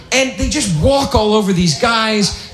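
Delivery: normal talking voice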